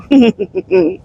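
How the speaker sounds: high burst of laughter; laughing. Real, not acted. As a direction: break into a laugh. Laughs at snoring joke.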